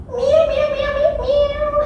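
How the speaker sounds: very high squeaky meows; happy cat meows. Pretend. Teacher meows for kids as a happy cat. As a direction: meow like a happy kitten, way up high. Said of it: "Happy cats".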